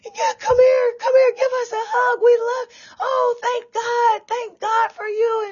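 high-pitched, tearful gushing imitation; gushing crowd. Church people begging the dreamer for a hug, gushing. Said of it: "And they're like".

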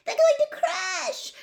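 very high, loud, drawn-out cry; terrified spectator's high scream; panicked shriek. A narrator's reading. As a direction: shriek it in alarm. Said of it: "shrieked Hermione".

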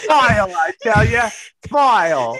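high, loud mock British; hammed-up British urchin, laughing; British orphan child. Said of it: "You sound like a little or-, orphan child".

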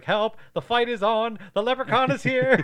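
high, loud mock panic; frantic high-pitched shout; panicked yell. Same quote retold again.

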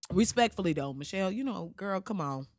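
ordinary talk

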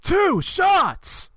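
high mock crowd cheer; imitated crowd answer. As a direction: chant it back high, like fans. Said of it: "publiken svarar ju med antalet skott".

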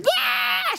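high delighted shriek; excited scream. Retold or imitated. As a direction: squeal with joy. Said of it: "like, screamed".

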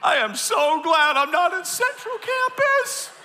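high, slow, mock relief; relieved onlooker. Pastor voicing relieved viewers at other campuses, high and slow. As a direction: ham up the relief of the safe campus. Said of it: "all of you at the other campuses are thinking".

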